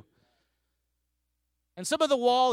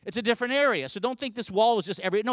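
usual speaking voice

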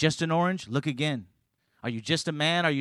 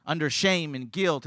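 usual speaking voice

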